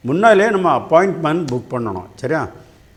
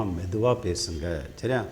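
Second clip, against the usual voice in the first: low, soft and slow nurse's request; hushed request; 7 semitones lower, 10 dB quieter, slower than usual. Acted. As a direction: quietly ask them to keep it down. Nurse hushing loud waiting-room talkers.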